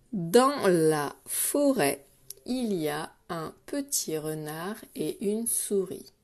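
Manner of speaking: slow, spaced-out dictation reading; measured reading for counting words. Shown as a demonstration. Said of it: "compter les mots".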